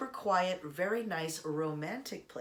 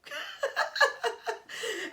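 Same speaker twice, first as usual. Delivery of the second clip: a loud, high burst of laughter; high laugh at her parents knowing already; 4 dB louder than usual. Real, not acted. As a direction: crack up at the punchline.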